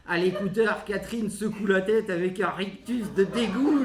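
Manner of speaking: ordinary talk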